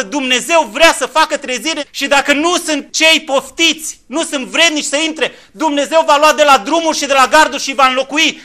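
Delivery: loud, high preacher's call; fiery sermon at full volume; full-force preaching. Acted. Preaching to the congregation.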